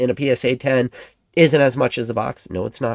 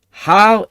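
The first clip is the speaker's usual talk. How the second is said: HOW said much higher and louder; stress on HOW; 10 semitones higher, 10 dB louder than usual. Real, not acted. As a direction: hit the word How hard and high. Hammering the video's title word.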